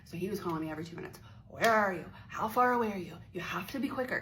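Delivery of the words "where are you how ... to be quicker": mock impatience. Acts caller nagging her on phone.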